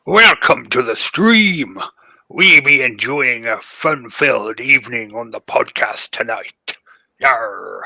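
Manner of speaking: loud, slow hearty pirate. Pretend. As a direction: growl it like a pirate captain. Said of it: "[name] talk like a pirate".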